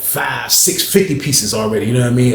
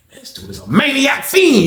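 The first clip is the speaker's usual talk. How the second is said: much higher, incredulous; amazed exclamation; 13 semitones higher than usual. Real, not acted. Telling viewers about Johnny, high and incredulous. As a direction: marvel at how crazy he is.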